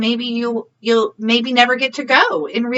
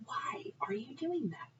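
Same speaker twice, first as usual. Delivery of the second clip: whispered title, soft and secret; hushed whisper of the story title; 20 dB quieter than usual. Real, not acted.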